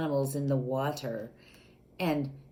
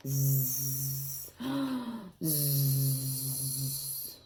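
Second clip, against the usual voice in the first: soft lower snoring buzz; playful pretend snoozing; mock snore; 4 semitones lower, 4 dB quieter than usual. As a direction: snore like a sleepy alligator. Alligator snoozing in story.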